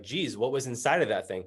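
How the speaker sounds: ordinary talk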